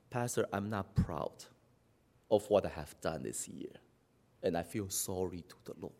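very low, hushed admission; acted soft, remorseful confession; contrite confession. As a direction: confess softly and low, like a penitent. Voices a repentant church member confessing.

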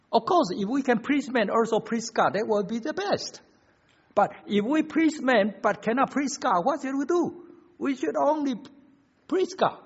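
high-pitched, insistent pulpit voice; impassioned preaching. Real, not acted. Preacher tells church whom to please, impassioned preaching. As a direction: build the argument to its verdict. Preacher drives argument to conclusion.

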